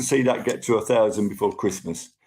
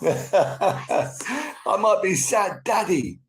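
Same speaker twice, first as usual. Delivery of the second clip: laughing; 6 semitones higher, much slower than usual. Real, not acted. Laughing self-pity joke.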